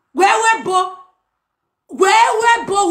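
loud sing-song callout. Acted. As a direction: call it out high and loud. Chanting a taunting call.

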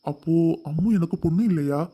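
deep fatherly voice, a touch of hurt anger; low older man, mildly offended; slight anger. A narrator's reading. Father snaps back at his wife with slight anger. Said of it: "லேசான கோபத்துடன் கேட்டார்".

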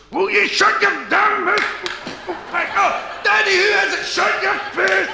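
high, screechy family yelling; hammed-up shouts from inside a noisy house; shouting household. Comic acting the racket behind a front door, yelling. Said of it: "he can hear all this noise".

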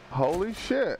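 ordinary talk

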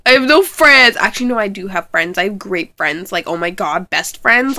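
ordinary delivery

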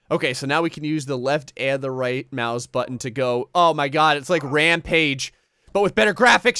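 ordinary talk, nothing marked

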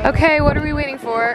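loud, fast and eager; excited. Real, not acted. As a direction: hype it up. Excited start with friend.

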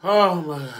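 loud, high outcry; pained exclamation. Real, not acted. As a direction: burst out in pain. Pain outburst.

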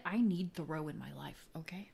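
whispered dramatic aside; theatrical whisper. Pretend. Vlogger whispers her Thoreau craving, half joking. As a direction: breathe it like a secret confession. Continues whispered quoting bit.

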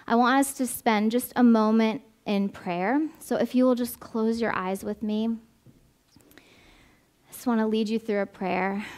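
ordinary delivery

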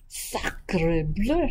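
lightly voiced French-exclaiming duck; mild storybook exclamation as the duck; duck's cry. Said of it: "she cried".

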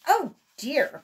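put-on an old rabbit's worried wail; dismayed cry. A narrator's reading. Uncle Wiggily seeing his airship leave, dismayed. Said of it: "cried Uncle Wiggily".

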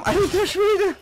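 high, loud amazed squeal; high amazed cry. Real, not acted. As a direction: squeal it in shock. Amazed on horror ride. (hoher erstaunter Ausruf)

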